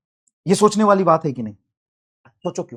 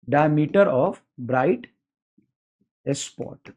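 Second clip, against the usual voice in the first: dictation; 4 semitones lower, 4 dB quieter, much slower than usual. Shown as a demonstration. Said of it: "dictating term".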